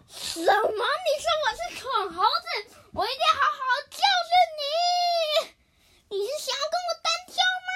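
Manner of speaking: high, loud, fast furious yelling; angry shout. Acted. Hippo furious at being called a stupid monkey, shouting. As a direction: explode at the insult, daring him. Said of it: "河马就生气了".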